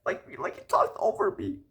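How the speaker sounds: high breathy mock voice; mocking girlfriend. Guy mimics date accusing him, high and breathy. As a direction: mimic her complaint, airy and high. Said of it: "she out here wanna talk about".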